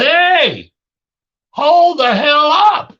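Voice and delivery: loud, high, slow holler down the stairs; yelling stop. Retold or imitated. Dad yelling down at the kids gaming, loud and slow. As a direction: bellow to stop them cussing. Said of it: "I said".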